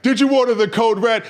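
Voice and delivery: loud, high-pitched demand; courtroom interrogator. Retold or imitated. Questioner confronting Colonel Jessup in A Few Good Men, shouted demand. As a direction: hammer out the accusation as a shout. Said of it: "like in A Few Good Men".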